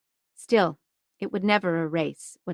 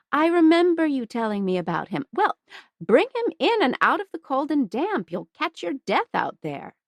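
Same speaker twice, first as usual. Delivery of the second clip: higher, fast, bustling matron; fussing older woman; 6 semitones higher than usual. Acted. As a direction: hurry them in like a kind landlady. Mrs Hudson invites warmly.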